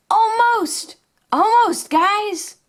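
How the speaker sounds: high, eager puppy voice; toy pup voice. Pretend. Chase chasing the pig, answering in a high pup voice. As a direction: answer like a breathless little cartoon pup.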